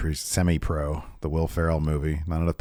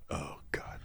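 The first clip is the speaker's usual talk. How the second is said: whispered; 21 dB quieter than usual. Acted. Panicked aside, forgot preview.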